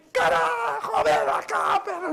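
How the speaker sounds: grito agudísimo y furioso. Retold or imitated. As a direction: imita a la esposa desgañitándose con la perra. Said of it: "Y yo le gritaba". (grito desesperado y enojado, actuado)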